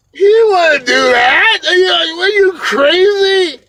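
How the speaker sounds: crying out, loud and high; real tears breaking through a shout; choked-up outburst; tearing up. Real, not acted. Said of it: "I'm tearing up".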